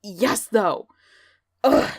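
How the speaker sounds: normal talking voice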